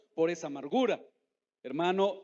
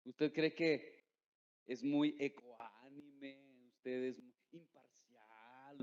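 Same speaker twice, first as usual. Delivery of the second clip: sarcástico; 4 semitones lower, 7 dB quieter, much slower than usual. Acted. Preacher mocks listener's self-image.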